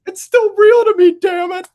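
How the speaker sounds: hammed-up, high loud tearful wail; mock crying. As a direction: imitate a wrestling fan bawling at the top of the voice. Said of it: "he starts crying and he goes".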